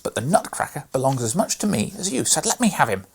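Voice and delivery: a brother claiming his share; demanding boy. A narrator's reading. Fred insisting to Maria he has equal right, loudly. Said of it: "said Fred".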